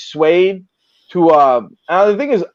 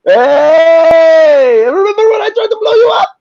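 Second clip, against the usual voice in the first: high, loud, falsely cheery voice; mock Palestinian greeting; 13 semitones higher, 7 dB louder, slower than usual. A guy cheerfully greeting the man he tried to bomb, high and loud. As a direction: sing it out high like an old friend. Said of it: "You got a bunch of Palestinians going".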